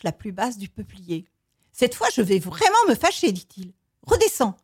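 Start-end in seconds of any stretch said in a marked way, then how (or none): 1.7-3.3 high, loud storybook threat; acted mounting annoyance; angry warning. A narrator's reading. Elephant threatens itself with anger. 4.1-4.6 high, loud storybook order; acted scolding with raised voice; sharp command. A narrator's reading. Elephant orders itself down.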